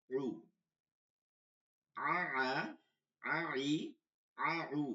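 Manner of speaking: pressed ayn vowels, drilled syllable by syllable; pressed throat articulation. Shown as a demonstration. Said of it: "এটা চাপ দিয়ে". (গলায় চাপ দিয়ে আইন-এর "আ", "ই", "উ" উচ্চারণ)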